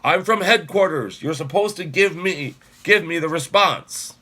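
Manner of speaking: loud, high authoritative demand; commanding. Acted. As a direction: order them like an officer. Bluffing officer demands response.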